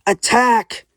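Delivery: loud, high charge order; battle cry. Acted. Cubert sends the penguins into battle, shouted order. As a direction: call the troops forward.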